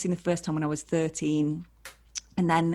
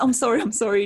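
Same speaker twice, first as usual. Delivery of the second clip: flustered apology; 5 semitones higher, 6 dB louder, faster than usual. Retold or imitated. Apologising to heartbroken fan.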